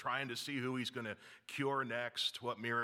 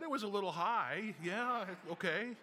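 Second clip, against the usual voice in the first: sarcastic understatement; 8 semitones higher than usual. Real, not acted. Ironic about 200 over 110.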